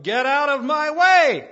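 full-force yell, high and drawn out. Retold or imitated. Large lady yells at smokers blocking the bus door, loud high yell. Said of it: "she yelled".

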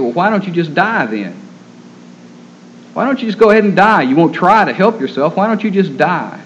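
husband's mad outburst, pitch jumping up; retold angry outburst. Husband fed up with his sick wife not eating, retold angrily. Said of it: "he got so mad".

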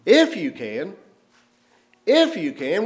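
"If you can" thrown back as an incredulous question; exclaiming question. Shown as a demonstration. Jesus echoing the father's doubt, an incredulous exclamation. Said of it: "interjects with an exclamation and a question mark".

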